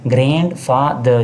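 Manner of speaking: Grandfather split into grand-fa-ther, slightly slow; three distinct beats on grandfather; syllable by syllable. Shown as a demonstration. Teacher repeating the three parts, syllable by syllable. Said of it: "तीन हिस्से हैं".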